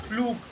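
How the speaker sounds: teacher modelling the long U of Flug; proper U in Flug. Shown as a demonstration. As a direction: hold the oo vowel in Flug. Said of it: "proper U sound".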